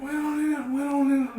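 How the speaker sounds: high Godfather mobster mumble; lightly done mob boss voice; Marlon Brando. Retold or imitated. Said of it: "your best Marlon Brando".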